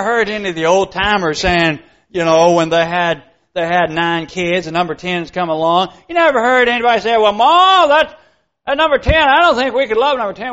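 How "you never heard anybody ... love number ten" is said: higher and louder, a put-on farmer protesting to his wife; lightly hammed-up old father's objection; mock old-timer complaint. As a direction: ham up a worried old dad pleading with Ma. Old timer voiced objecting to child.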